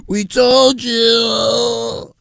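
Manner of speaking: high, drawn-out crying gloat; mock tearful fan victory cry; gloating sports fans. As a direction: wail it like a fan finally proven right. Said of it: "the people are gonna be like".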